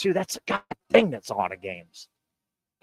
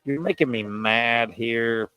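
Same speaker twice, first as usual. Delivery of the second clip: ordinary talk, nothing marked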